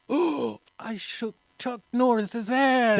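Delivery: high, loud fanboy outburst; starstruck squeal. Retold or imitated. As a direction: reenact a kid bursting with excitement, drawn out. Reenacts starstruck boy after handshake.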